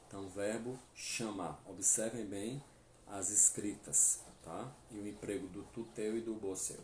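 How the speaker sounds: normal talking voice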